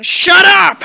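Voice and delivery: sudden high, loud yell; yelling at the whole group to shut up. Acted. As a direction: explode into a shout. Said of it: "Can you, like, stop yelling?".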